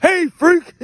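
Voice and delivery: imitating his drill instructor's shout; drill instructor yell. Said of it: "his favorite phrase".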